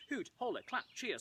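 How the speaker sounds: ordinary talk, nothing marked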